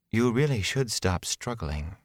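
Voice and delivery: coolly patronizing villainess; unhurried, quiet condescension; coolly menacing woman. Acted. Villain woman addresses her captors.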